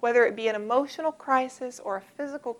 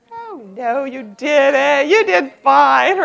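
louder, higher, laughing dismissal; breezy brush-off; 3 semitones higher, 11 dB louder, slower than usual. Retold or imitated. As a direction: laugh it off as if nothing happened. Family brushing off the failure.

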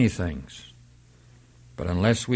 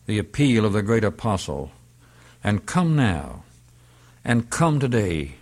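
usual speaking voice

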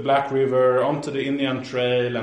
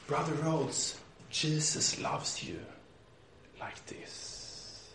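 very soft, slow, fading to a whisper; tender reassurance; 16 dB quieter, much slower than usual. Retold or imitated. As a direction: comfort a brother in a hushed voice. Retold comforting of weeping brother.

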